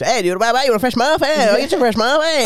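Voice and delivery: put-on high, bright squeaky kid voice; young Elliot voice. Friend mimicking how Elliot talks, high squeaky voice. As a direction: squeak it out like a boy at the till. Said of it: "That's exactly how he talks".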